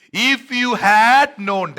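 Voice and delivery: loud, high, very slow lament; Jesus crying out. Acted. Jesus weeping over Jerusalem, loud drawn-out lament. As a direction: plead over the city, drawn out. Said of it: "and he's crying".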